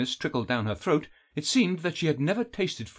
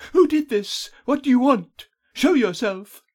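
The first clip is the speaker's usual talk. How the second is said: very high, loud demands; captive's loud, high-pitched challenge; demanding shout; 12 semitones higher, 7 dB louder than usual. A narrator's reading. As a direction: call out to an unseen captor. Bound captive calls into darkness.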